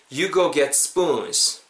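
"You go get spoons" slow, with gliding go and long spoons; slow repeat-after-me model. Shown as a demonstration. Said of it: "한번 따라해 보실까요?". ("You go get spoons"를 천천히 따라 하기용으로)

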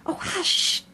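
urgent high hushing, whispery; frightened whisper. A narrator's reading. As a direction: shush her in alarm. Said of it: "whispered in a frightened tone".

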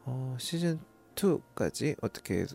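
usual speaking voice

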